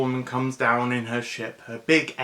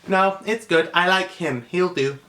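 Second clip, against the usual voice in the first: higher, firm insistence; a hint of a stubborn girl; 6 semitones higher, 5 dB louder than usual. Girl insisting she keeps the dog, lightly voiced. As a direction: settle it like a kid. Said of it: "kid insists after laugh".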